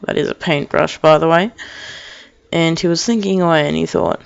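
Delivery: ordinary delivery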